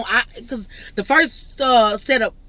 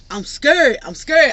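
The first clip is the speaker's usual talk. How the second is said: higher and louder, a touch of retold panic; mock scared; 5 semitones higher, 5 dB louder, slower than usual. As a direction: plead that you're scared. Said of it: "retelling her own fear".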